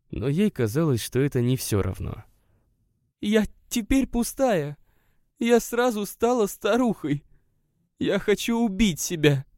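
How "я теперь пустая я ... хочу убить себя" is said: she feels emptied out; despairing woman. A narrator's reading. Narrator voices the guest. (женщина в отчаянии, медленно)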